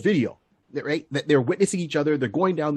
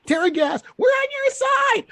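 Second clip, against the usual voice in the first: high-pitched yelp, climbing higher; mock panicked shouting. Convoy protesters pleading with police as tear gas comes, mock panic. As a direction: imitate protesters wailing in protest.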